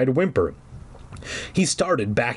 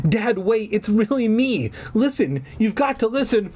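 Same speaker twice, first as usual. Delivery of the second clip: high, urgent plea to dad; pleading; 9 semitones higher than usual. A narrator's reading. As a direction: beg to be recognized, pitched high. Dog-boy pleading with his father.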